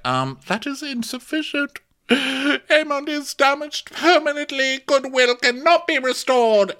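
high-pitched, hammed-up royal protest; mock indignant queen. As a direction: protest like an outraged mother. Acted queen protesting to king.

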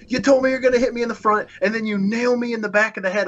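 high, fast, outraged complaint; retold indignation. Wrestler retells scolding the man who hit him, high and indignant. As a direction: re-enact an aggrieved telling-off, pitch held high. Retold angry confrontation after the match.

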